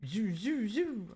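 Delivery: playful whooshing toy sound; dad's toy noise. Retold or imitated. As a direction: mimic dad's toy demo. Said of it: "He goes, "This is how you use it".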